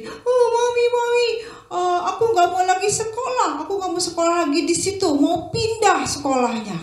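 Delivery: put-on high, pleading little boy; whiny child. Boy begging his mother to change schools, high and whiny. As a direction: beg like a schoolboy who wants out. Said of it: "terus dia bilang gini".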